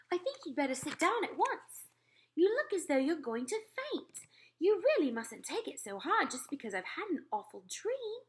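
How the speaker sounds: a queen still upset by a nightmare; queen's voice. Acted. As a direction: tell the dream as a rattled queen. Queen orders maid to sit.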